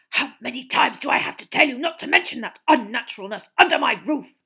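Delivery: angry hiss, loud and rough. A narrator's reading. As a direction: hiss it in fury. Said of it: "he hissed".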